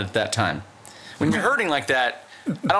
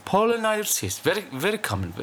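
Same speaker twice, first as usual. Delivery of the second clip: put-on Indian accent; 8 semitones higher than usual. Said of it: "His accent slipped into Russian".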